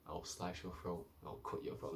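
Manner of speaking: low-key whispered threat to a rival; menacing threat. Retold or imitated. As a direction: hiss the threat quietly. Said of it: "Arya's like".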